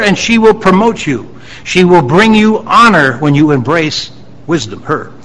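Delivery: preacher declaiming a father's commands; exhorting scripture reading. Real, not acted. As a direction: press each command on the listeners. Reads proverb as urgent exhortation.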